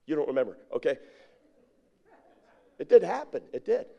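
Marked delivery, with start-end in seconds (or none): none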